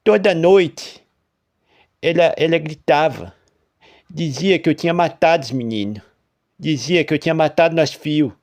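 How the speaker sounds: tormented farmer voice; haunted farmer. A narrator's reading. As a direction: recount the nightmare with dread. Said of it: "O lavrador continuou".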